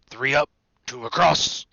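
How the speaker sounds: loud, absorbed big-man muttering; muttering count. A narrator's reading. Hagrid counting bricks, heavy-voiced muttering. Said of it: "he muttered".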